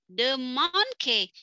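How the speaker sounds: monkey drawn out slowly as "man-ke"; student's misreading. Shown as a demonstration. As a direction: read monkey like a struggling student. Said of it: "Kan misalnya dia baca".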